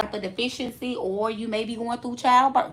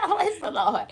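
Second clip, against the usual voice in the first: laughing; 7 semitones higher than usual. Real, not acted. Laughing after friend's reply.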